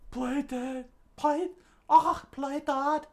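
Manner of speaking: high squeaky stage aside; mock panicked whispering. A narrator's reading. Nami and Usopp collapse before the giant, squeaky mock whisper. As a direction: squeak it urgently in falsetto.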